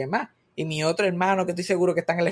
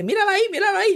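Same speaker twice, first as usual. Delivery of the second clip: high, louder insistent imitation of dad; dad pointing it out; 5 dB louder, much faster than usual. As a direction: mimic the father eagerly urging you to look. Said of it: "Él me dice". (imitando al papá, agudo y fuerte)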